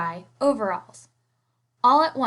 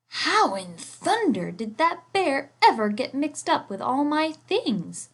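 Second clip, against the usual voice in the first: wondering artist; 5 semitones higher, 4 dB louder than usual. A narrator's reading. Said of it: "the artist wondered".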